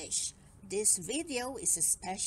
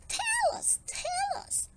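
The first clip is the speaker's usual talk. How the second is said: high, loud excited little fish; eager pleading; 5 dB louder than usual. A narrator's reading. Fish beg Barry to tell.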